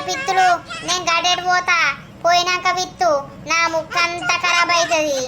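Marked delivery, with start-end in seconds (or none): none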